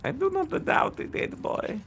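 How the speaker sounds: mocking villain voice. Taunting friend in game.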